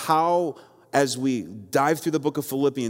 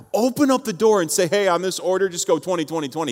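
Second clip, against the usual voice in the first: mildly heated, raised pitch, retelling a complaint to a wing clerk; 6 semitones higher than usual. Retold or imitated. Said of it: "I got really heated in the moment".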